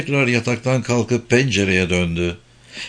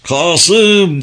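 loud, higher calling out; piercing shout; 7 semitones higher, 5 dB louder than usual. A narrator's reading. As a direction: shout the name as if into the distance. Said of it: "havayı göktaşı gibi yırtan bir sesle". (yüksek, tiz sesle bağırarak seslenme)

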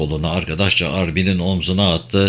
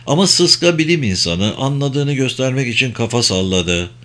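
plain reading voice

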